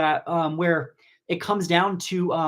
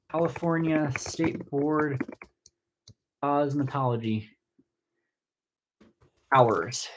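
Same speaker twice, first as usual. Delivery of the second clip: slow, low and spaced out, with long pauses; murmured to oneself while looking something up; absent-minded; 3 semitones lower, slower than usual. Real, not acted. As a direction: say it piecemeal while typing a search. Typing a search while speaking.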